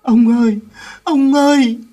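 very high, loud grieving wail. Acted. Boy wailing over his dead grandfather, loud and high. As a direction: cry out for him with everything. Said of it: "Quý mới gào lên".

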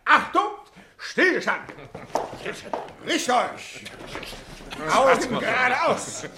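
high parade-ground calls, last one drawn out; military drill commands. Acted. Classmate drilling the old school class, military command. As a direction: call the class to attention like a drill sergeant.

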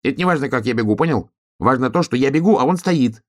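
high, excitable hedgehog voice; insistent hedgehog. Acted. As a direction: explain urgently as a high-voiced little hedgehog.

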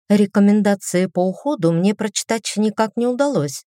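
ordinary delivery, nothing marked

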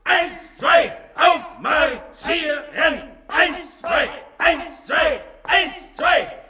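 high, shouted marching count; drill count. Acted. Leader drilling his followers in marching, shouting. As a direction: bark the beat like a drill sergeant.

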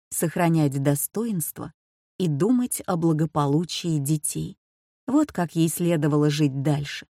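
plain reading voice

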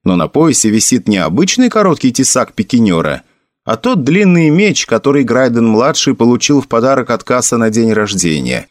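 ordinary delivery, nothing marked

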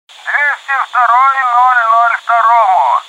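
excited hissing voice over a pilot headset; excited radio voice. Acted. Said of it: "Его возбуждённый и шипящий голос сложно спутать". (возбуждённый шипящий голос в эфире)

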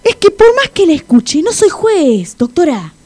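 loud, high, exasperated protest; exasperado. Acted. Discute exasperado con la doctora. (protesta exasperada, en voz alta y aguda)

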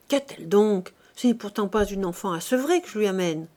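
ordinary delivery

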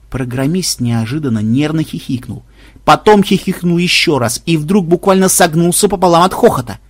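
ordinary delivery

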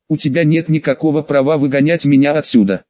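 ordinary delivery

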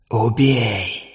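soft, whispered inner voice; whispering voice. A narrator's reading. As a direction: whisper it in his mind. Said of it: "шептали в сознании".

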